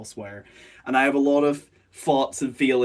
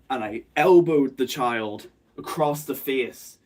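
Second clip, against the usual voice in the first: ordinary delivery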